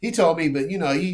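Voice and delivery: ordinary talk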